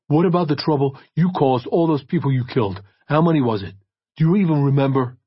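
loud, high accusing questions; angry accusation. A narrator's reading. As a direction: throw the accusation at him. Detective angrily accusing.

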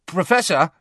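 high, loud bid for attention; eager schoolboy. A narrator's reading. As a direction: pipe up keenly. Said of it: "said Malfoy eagerly".

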